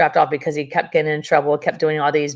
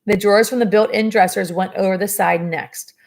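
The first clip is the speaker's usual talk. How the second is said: plain reading voice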